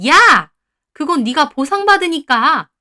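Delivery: loud, high outburst, drawn out; indignant yell. A narrator's reading. In-law protesting about the compensation money, indignant yell. In-law yells back in quarrel.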